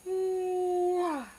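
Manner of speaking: a long yawning ooh; real sleepy yawn. Real, not acted. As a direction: let a yawn drag out the sound. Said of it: "excuse me, yawning at your".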